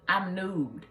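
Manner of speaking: normal talking voice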